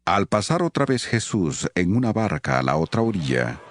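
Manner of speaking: ordinary delivery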